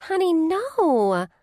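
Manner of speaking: slow, high tender comfort; gentle, drawn-out reassurance; compassionate. A narrator's reading. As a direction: soothe a crying friend. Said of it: "Her voice changes from excited to compassionate".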